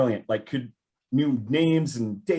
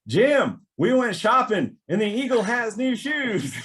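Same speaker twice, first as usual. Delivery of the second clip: imitating a Tennessee accent, higher and slow; 13 semitones higher, 5 dB louder, slower than usual. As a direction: put on a friend's Tennessee accent, pitched up. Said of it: "I think it's Tennessee accent".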